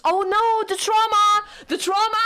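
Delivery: high, loud fan panic; alarmed outcry. Real, not acted. Streamer exclaims over kiss scene.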